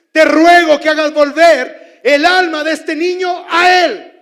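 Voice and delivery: loud, high cry to God; preacher acting out Elijah crying out to God, loud; preaching at full force.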